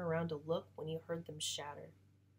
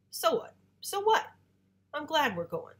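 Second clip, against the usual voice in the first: much higher, louder defiant cry; defiant outburst; 10 semitones higher, 9 dB louder than usual. Acted. Boy insisting he doesn't care, defiant and loud. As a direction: push the hurt away loudly.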